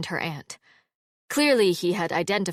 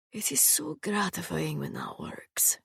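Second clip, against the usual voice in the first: whispered, much softer aside; murmur; 7 dB quieter than usual. A narrator's reading. Sarkis quietly telling Halla after the threat, murmured. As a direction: confide it quietly, pleased. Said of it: "murmured Sarkis".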